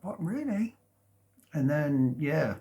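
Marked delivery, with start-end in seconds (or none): 0.0-0.8 high, rising surprised question; acted disbelief. Reacting to a friend's coming out, retold surprise. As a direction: imitate someone taken aback.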